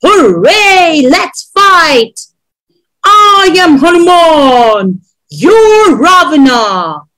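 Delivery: drawn-out playful war cry; excited shout. A narrator's reading. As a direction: shout it like a child playing warrior. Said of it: "I shout".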